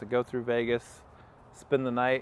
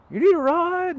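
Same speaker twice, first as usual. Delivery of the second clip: much higher and louder, a put-on calling voice; bus driver's call; 9 dB louder than usual. Bus driver calling out to the hitchhiker, high and loud put-on voice. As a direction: call out high and loud like a stranger offering a lift. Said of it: "this voice goes".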